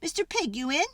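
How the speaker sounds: light sing-song call at the stick house; mock-polite calling at the door. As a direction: call through the door, half-hearted. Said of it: "I called".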